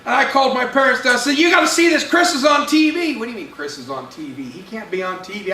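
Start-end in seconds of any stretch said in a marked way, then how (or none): 1.4-3.2 high, urgent excitement; excited boy. Acted. Boy calls his parents down to the TV, excited. 3.2-5.5 skeptical parents. Acted. Parents answer their excited son, disbelieving.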